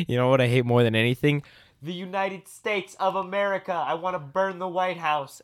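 high-pitched mock rant; hammed-up high proclamation; imitating outrageous friend. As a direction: declaim it high, like a wacky provocateur. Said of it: "You'd be like".

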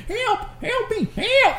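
put-on a scared little boy squealing; mimicking a panicked kid. Retelling the boy running upstairs, high frantic cries.

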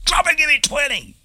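very high, loud screamed order; drill sergeant shout. Retold or imitated. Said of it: "the drill sergeant walks up".

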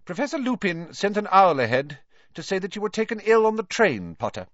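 high, quick, brisk teacher voice; abrupt. A narrator's reading. Professor McGonagall telling Potter about Lupin's owl, abrupt. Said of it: "said abruptly".

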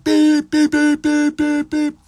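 very high, loud shouted bleeps; censor bleeps. Retold or imitated. Voicing shouted bleeps over slurs at Stephen A Smith. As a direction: blast out bleep sounds like a censor. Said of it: "I would have been like".

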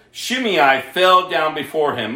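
ordinary talk

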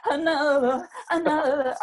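high, mock singer wail. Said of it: "they were really like".

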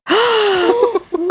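big, high shocked gasp. Real, not acted. Friend hears about the drugged cookie, shocked gasp. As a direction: gasp loudly at a scandal.

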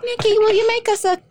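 high-pitched mock British parents; British accent. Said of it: "I don't know why I'm giving them an accent now".

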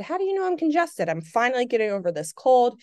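high, fast startled question; retold surprise. Telling the facialist, surprised and high-pitched. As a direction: blurt it out in disbelief.

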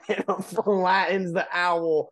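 high, slow punchline through laughter; drawn-out, laugh-choked telling. Real, not acted. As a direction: barely hold it together on the punchline.

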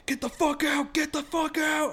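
high, strained mock shout; furious yell, replayed for the story; imitated enraged yelling. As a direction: act out a road-rager yelling. Imitates road-rager charging at car.

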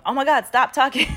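exasperated calling out. Retold or imitated. Shopper in headphones interrupts two talkative strangers, exasperated and loud. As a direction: cut in loudly over chatter. Acted shouting over chatter, headphones in.

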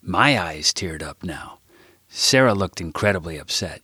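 ordinary delivery, nothing marked

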